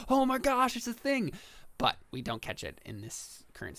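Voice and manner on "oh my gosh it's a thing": high-pitched mock gasp of discovery; hammed-up astonishment; imagined viewer's amazement. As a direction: exclaim it high, like a fan spotting a secret. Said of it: "and be like".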